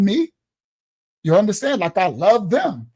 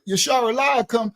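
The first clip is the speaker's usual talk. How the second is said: ordinary delivery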